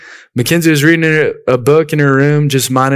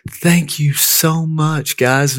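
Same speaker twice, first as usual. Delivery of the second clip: higher, drawn-out, touched thank-you; dumbfounded gratitude; 4 semitones higher, much slower than usual. Retold or imitated. Younger sister thanking siblings for the milkshake, dumbfounded and grateful. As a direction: imitate a sister stunned by a kind gift. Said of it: "she's dumbfounded".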